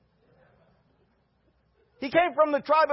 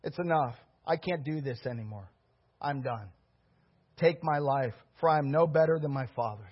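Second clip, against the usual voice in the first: despairing Elijah; 10 semitones lower, 6 dB quieter than usual. Acted. Pastor voices despairing Elijah.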